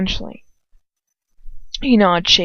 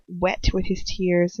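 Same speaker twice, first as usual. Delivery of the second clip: ordinary delivery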